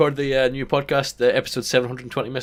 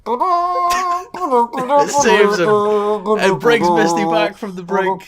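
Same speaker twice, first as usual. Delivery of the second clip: much higher, slow, louder epic announcing; dramatic narration; 10 semitones higher, 5 dB louder, much slower than usual. Acted. Game master announcing the rescue sound, slow and epic. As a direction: stretch it out like a trailer voice.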